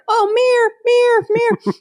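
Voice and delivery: high, far mocking British voice repeating mirror; mock British teasing. Mocking British friends, high teasing voice. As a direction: play the Brits mocking her Pittsburgh mirror. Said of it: "They'd say".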